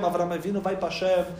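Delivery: usual speaking voice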